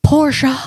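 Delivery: "Porsche" said as POR-shah; imitated "Porsche", ending in an open ah; Porsche as two syllables. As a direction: give "Porsche" a full second syllable, shah. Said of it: "he said it's Porsche".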